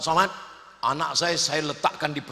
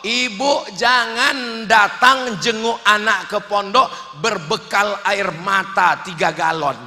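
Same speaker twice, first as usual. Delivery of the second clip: normal talking voice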